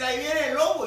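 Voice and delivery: put-on boy crying wolf for real this time; mock alarm cry. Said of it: "volvió a decir".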